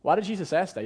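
ordinary talk

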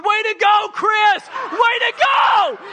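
very high, loud cheering shout; proud parents yelling. Retold or imitated. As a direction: cheer your son from the stands. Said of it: "They're yelling".

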